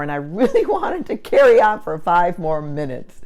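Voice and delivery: talking through a laugh. Real, not acted. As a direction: laugh while telling it. Laughing about ranting client.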